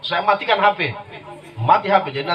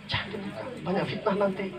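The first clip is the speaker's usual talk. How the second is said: a quiet aside warning him not to open it; hushed warning; 2 semitones higher, 11 dB quieter than usual. Retold or imitated. Ustadz menasihati pelan. (ustadz menasihati dengan suara pelan)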